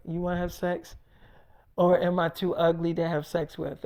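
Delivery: put-on low, soft girlfriend quote; quiet, insecure asking. Pregnant girlfriend asking boyfriend if she's too ugly, quietly. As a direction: ask it small and low. Girlfriend asks him, unsure of looks.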